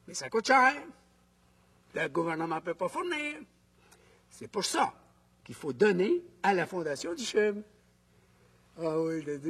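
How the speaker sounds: ordinary talk, nothing marked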